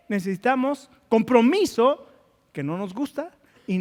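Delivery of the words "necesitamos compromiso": loud, driving sermon voice; full-force preaching. Acted. Pastor exhorts the congregation, loud fervent preaching.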